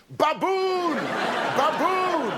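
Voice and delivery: young lad mimicking the crowd chant; lad shouting. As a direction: yell it at him mockingly. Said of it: "he goes".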